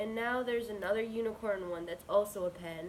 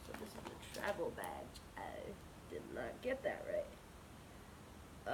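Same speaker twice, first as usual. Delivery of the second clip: soft whispered aside to herself; whispering; 10 semitones lower, 8 dB quieter, slower than usual. Real, not acted. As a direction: whisper it under your breath. Muttering to herself about badge.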